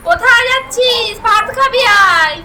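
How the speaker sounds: high-pitched, drawn-out call; real motherly call to come and eat. Real, not acted. Calling child back to eat.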